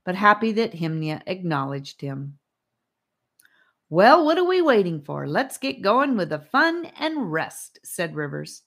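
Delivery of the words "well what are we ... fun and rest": high, loud cheery call. A narrator's reading. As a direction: bounce it out, upbeat and loud. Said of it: "back to his usual cheery voice".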